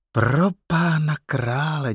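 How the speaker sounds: a mother's drawn-out wonder; amazed mother. A narrator's reading. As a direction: marvel slowly, drawing out the words. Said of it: "divila se Teddyho maminka".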